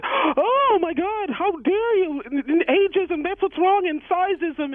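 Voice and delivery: very high, very slow, whiny mock cry; mock outraged tweeter. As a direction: whine out the outrage like a hammed-up offended fan. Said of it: "It was like".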